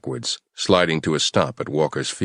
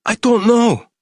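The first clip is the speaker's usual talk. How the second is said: terrified denial; 7 dB louder, slower than usual. Acted. Terrified captive denies.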